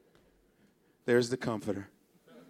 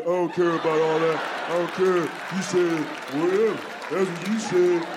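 caveman voice; 4 dB louder than usual. Retold or imitated. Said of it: "same caveman voice".

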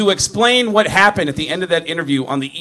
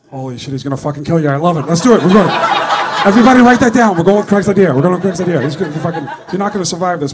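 fast, louder, thrilled; excited producer; 5 dB louder, faster than usual. Retold or imitated. Producer hypes the staff on the prank, excitedly. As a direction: rush it like you can't wait. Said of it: "Gary looked at me and went".

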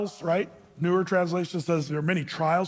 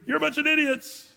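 mock angry yell, pitched high; scoffing insult hurled at people; mock scream; 8 semitones higher than usual. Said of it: "do you scream".

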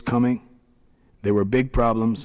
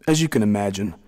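plain reading voice

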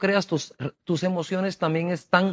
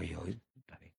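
meek surrender; 10 semitones lower, 20 dB quieter than usual. Retold or imitated. Prophet meekly giving in.

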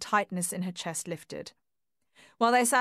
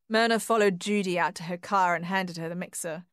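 plain reading voice

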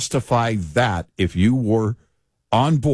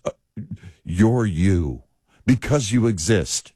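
ordinary delivery

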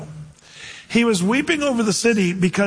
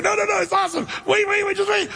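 much higher, giddy eager voice; hammed-up eager Jesus; 14 semitones higher, faster than usual. Jesus at the tomb telling the mourners, giddy and high. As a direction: tease them like a kid who can't keep a secret. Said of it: "he's like".

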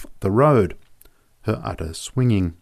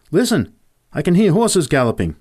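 higher and a touch louder, alert and urgent; sudden exclamation; 7 semitones higher, 3 dB louder than usual. A narrator's reading. Joe alerting his friend to approaching horses, urgent exclamation. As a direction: call out the moment you catch the sound. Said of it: "Joe suddenly exclaimed".

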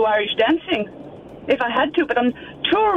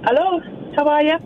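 put-on Irish accent; 2 semitones higher, faster than usual. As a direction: let the Irish accent slip into the greeting. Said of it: "you've got an accent now".

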